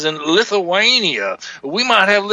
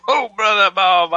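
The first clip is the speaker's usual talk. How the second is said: ordinary talk, nothing marked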